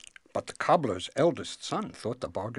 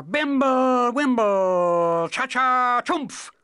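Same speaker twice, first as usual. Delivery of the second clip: high, loud, drawn-out incantation; wizard casting spell; 12 dB louder, much slower than usual. Acted. Wizard recalls an old spell aloud, incanting. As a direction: chant nonsense magic words, high and loud.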